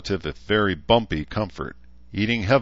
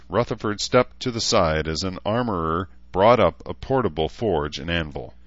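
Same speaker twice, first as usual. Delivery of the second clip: ordinary delivery, nothing marked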